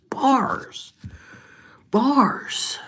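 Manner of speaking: high, loud burst; hype exclamation. Pretend. Rapper hyping his own bars, high shout. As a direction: cry it out high like a hype man.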